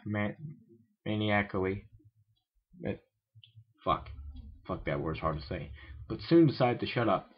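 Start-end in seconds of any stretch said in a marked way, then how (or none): none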